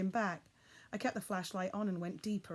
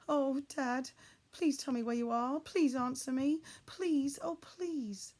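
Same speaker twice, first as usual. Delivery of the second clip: higher, pleading voice breaking toward the end; trembly pleading; 7 semitones higher, 4 dB louder, slower than usual. A narrator's reading. Frightened boy begging his missing father to answer, trembly. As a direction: beg him to answer, near tears. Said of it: "my voice began to go all trembly".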